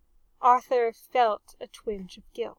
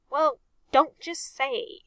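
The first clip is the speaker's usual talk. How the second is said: a mild fierce retort, a bit louder and slower; 4 semitones higher, 5 dB louder than usual. Acted. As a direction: snap it back, a touch fierce. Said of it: "Reg said fiercely".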